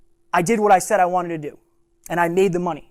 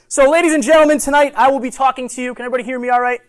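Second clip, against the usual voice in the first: higher and louder, projecting to the room; in front of an audience; 6 semitones higher, 5 dB louder, faster than usual. Real, not acted. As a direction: address the whole room. Speaker opening talk to audience.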